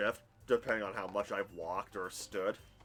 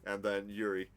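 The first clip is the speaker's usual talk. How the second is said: ordinary delivery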